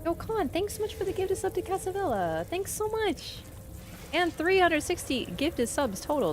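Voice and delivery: high, bright excited thank you. Real, not acted. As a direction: gush with gratitude. Thanking a gifted sub.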